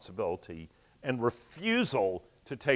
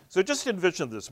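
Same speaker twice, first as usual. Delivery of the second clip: usual speaking voice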